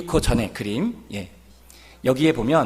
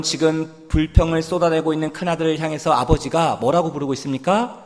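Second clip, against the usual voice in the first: ordinary delivery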